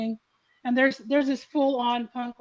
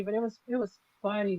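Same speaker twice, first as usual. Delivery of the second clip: ordinary talk, nothing marked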